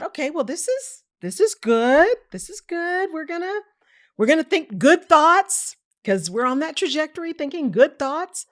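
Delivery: high-pitched, self-coaxing positivity; forced optimism. Retold or imitated. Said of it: "Gonna be optimistic".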